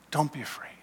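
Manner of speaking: whispered "Don't be afraid", no voice at all; whispering tender reassurance; whispered comfort. Acted. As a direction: breathe the words like a secret blessing. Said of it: "in compassion and love and forgiveness".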